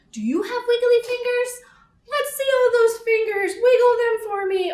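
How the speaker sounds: put-on high, bright coaxing to kids; excited. Librarian rallying kids to wiggle fingers, excited. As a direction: lift it up and cheer them on.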